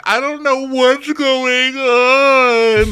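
loud, high, drawn-out fake wail; hammed-up weepy howl; mock crying. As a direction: bawl it out slowly, mocking. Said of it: "So Camille's crying now".

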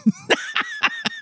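sudden high-pitched burst of laughter; real giggle fit; high squealing laugh. Real, not acted. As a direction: crack up in a squeal. Host cracks up at bit.

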